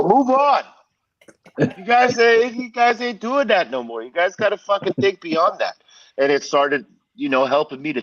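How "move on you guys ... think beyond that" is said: imitating the boss telling the band to grow up, much higher; lecturing boss. Donnie telling the band to drop the gangster talk, imitated mentor lecture. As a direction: push them like an impatient mentor.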